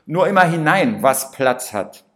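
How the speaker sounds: loud, hearty call; booming invitation to eat up; cook calling out. Acted. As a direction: shout it up to him like a jolly cook. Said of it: "Der österreichische Koch ruft".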